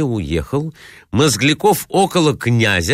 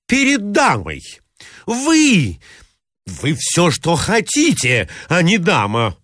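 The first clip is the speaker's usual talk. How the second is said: much higher, scornful outcry; indignant shout; 7 semitones higher than usual. Acted. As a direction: throw the insult at her. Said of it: "вскричал Мозгляков". (высокий, презрительный выкрик)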